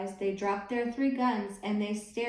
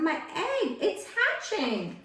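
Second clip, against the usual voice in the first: shout; 7 semitones higher, slower than usual. Acted. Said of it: "shouted Horton".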